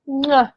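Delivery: short "mwah" kiss sound; smacked kiss with a falling "ah"; blown kiss. Real, not acted. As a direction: blow a quick kiss goodbye. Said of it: "Angel kisses to each of you".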